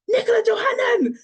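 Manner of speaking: high-pitched, loud imitated fan squeal; exploding excitement. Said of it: "persona just exploded".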